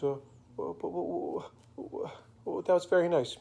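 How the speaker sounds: put-on halting, stuttering little girl; flustered stammer. Susie Q answers Mister Magoo's surprise offer, flustered and stammering. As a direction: stumble over the first words, unsure.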